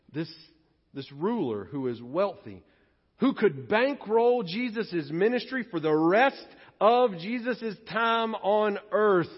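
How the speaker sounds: usual speaking voice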